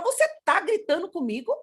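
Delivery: high, rising indignant question; modelled challenge. Retold or imitated. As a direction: imitate a parent challenging a child. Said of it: "é nós que vamos falar".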